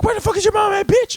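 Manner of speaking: very high, squeaky mock outburst; hammed-up squeaky child talking tough; angry kid gamer. Twelve-year-old clapping back at a troll, squeaky mock anger.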